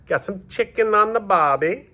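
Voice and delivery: a deliberately bad Australian accent while grilling; poor Australian accent. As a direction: ham up a clumsy Aussie accent for one line. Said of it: "very poor excuse for an Australian accent".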